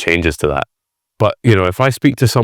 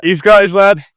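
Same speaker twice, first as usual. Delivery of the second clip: higher, louder Scottish greeting; Scottish accent; 11 semitones higher, 5 dB louder than usual. Retold or imitated. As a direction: hail a fellow Scot in a Scottish accent. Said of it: "if I notice a Scottish accent".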